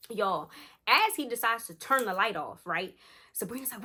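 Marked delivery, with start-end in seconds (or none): none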